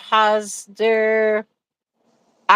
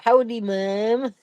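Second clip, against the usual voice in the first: mock Alberta drawl; 4 dB quieter, slower than usual. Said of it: "they speak like a".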